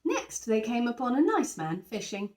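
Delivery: plain reading voice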